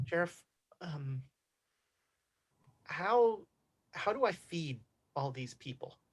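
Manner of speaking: embarrassed, barely speaking up; embarrassed sheriff. Acted. Embarrassedly tries to get your attention.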